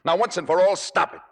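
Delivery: high, heated tirade ending in a loud order; angry outburst. Acted. Boyfriend fed up with her jealous harping, angry outburst. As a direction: build to a forceful, high command.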